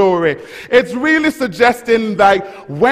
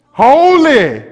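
high, loud, ringing holy; holy shouted out in praise; powerful cry of holy; 7 semitones higher, 7 dB louder than usual. Shown as a demonstration. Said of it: "Come on, let some power".

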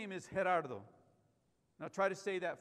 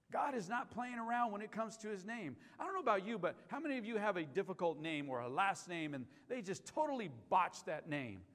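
usual speaking voice

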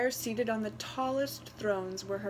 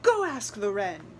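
commanding; 6 dB louder than usual. A narrator's reading. Parents commanding.

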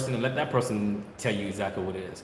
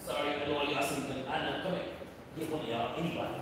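much softer, aside to the dogs; 4 semitones higher, 9 dB quieter than usual. Real, not acted. Breeder aside to his dogs off camera, much softer.

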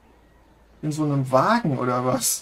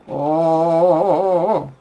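alarmed; 2 semitones higher, 8 dB louder, slower than usual. Real, not acted. Fish struggling in game.

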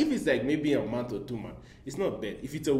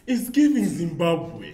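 sassy; 9 semitones higher, 13 dB louder, slower than usual. Pretend. Joking answer, teasing friends.

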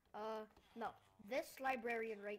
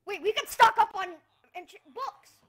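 much higher, much louder burst; excited shout; 9 semitones higher, 19 dB louder than usual. Real, not acted. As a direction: blurt the idea out. Sudden idea, shouted.

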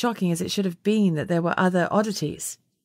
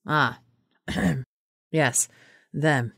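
low, awkward hedge with a throat clear; uncomfortable; 3 semitones lower, much slower than usual. A narrator's reading. Wilf is caught out about unicorns, awkward. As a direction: squirm a little. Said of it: "Wilf coughed uncomfortably".

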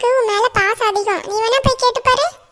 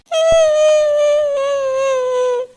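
mocking laugh; 5 semitones higher than usual. Siblings mock crying girl.

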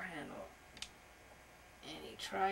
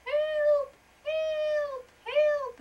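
very high, loud squealed cries; yelling for help; 10 dB louder, much slower than usual. A narrator's reading. Trapped butterfly calls out, high yelling. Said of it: "a voice yelling".

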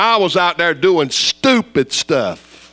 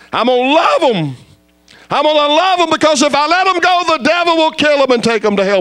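full-force preaching; 11 semitones higher than usual. Acted. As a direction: cry it out as God's promise. Preacher voicing God's mercy.

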